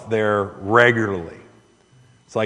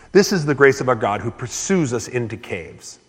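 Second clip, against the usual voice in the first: ordinary delivery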